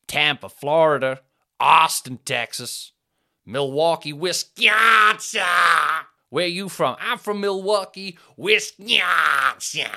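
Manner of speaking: a stretched-out, hammed-up American accent; mock American accent. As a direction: ham it up like a loud American local. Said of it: "Same accent".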